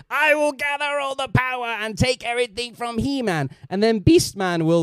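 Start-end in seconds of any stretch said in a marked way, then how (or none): 0.1-3.5 Skeletor impression. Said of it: "he'll be like".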